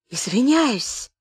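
slow, fawning apology; ingratiating. A narrator's reading. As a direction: soften up and wheedle. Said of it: "сказал он вкрадчивым голосом".

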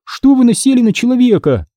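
very high, indignant challenge; interrupting protest. A narrator's reading. Girl defending Alex from both boys, interrupting. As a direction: take the boys to task, pitched high. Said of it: "перебила его Ханна".

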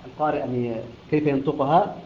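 ordinary delivery

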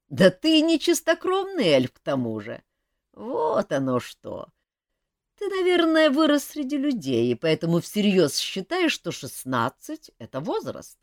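put-on high, loud amused elf voice, teasing the boy; a bemused grown-up elf laughing at a kid. Elf teasing the runaway boy, high and loud.

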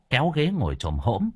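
usual speaking voice

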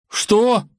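high, startled cry; shocked prince's shout. A narrator's reading. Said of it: "крикнул Иван".

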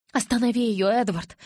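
plain reading voice

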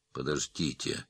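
soft, low, near-whispered plea. A narrator's reading. Wounded man begs his killers to wait, near whisper. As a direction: barely get it out, weakly. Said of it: "прошептал Махмудбеков".

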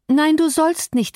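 high, slow, insistent plea; desperate refusal. A narrator's reading. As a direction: forbid him desperately to leave. Catherine clings, forbids him to go. (verzweifelt flehend, hohe Stimme)

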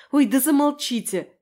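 exasperated, high, loud bark. A narrator's reading. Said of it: "рявкнула я".

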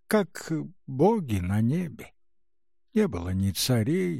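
plain reading voice

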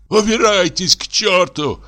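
high, slow, crying yell; desperate, half-sobbing cry; tearful shout. A narrator's reading. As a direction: shout through tears. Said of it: "крикнул он плачущим голосом".

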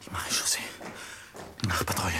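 whispered echo of the name; a hunted man repeating the patrol's name under his breath; fearful whisper. Acted. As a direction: breathe the words in fear. Hiding from the night patrol.

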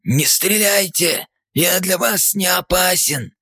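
high, slow, breathless plea; gasping out of breath, wheezing. A narrator's reading. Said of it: "задыхаясь, прохрипел".

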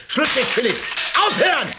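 high, loud command to stop; stern shout. Acted. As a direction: order him to stop, loudly. Nurse stopping a rampage.

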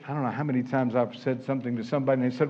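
ordinary talk, nothing marked

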